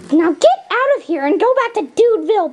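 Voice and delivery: usual speaking voice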